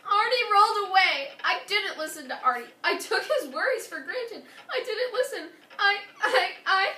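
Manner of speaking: loud, distressed cry to a friend. Acted. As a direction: blurt it out in a panic, voice rising. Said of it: "Abu cried to Ellie".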